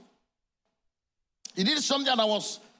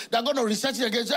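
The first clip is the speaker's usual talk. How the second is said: ordinary talk, nothing marked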